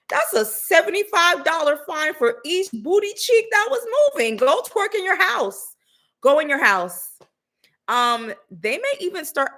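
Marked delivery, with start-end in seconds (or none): 0.0-7.2 high, hammed-up bossy officer with a squeal of laughter; mock cop. Mock cop fining a twerker, high and hammed-up. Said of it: "It's like".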